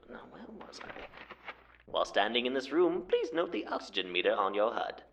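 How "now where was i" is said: unvoiced whispered aside. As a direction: whisper it to yourself, off mic. Aside after hot-mic mishap.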